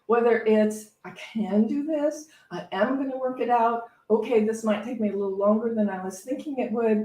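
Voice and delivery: ordinary delivery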